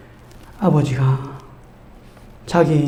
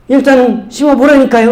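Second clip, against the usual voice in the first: tag; 6 semitones higher, 5 dB louder than usual. Retold or imitated. Boy insists on his sales pitch.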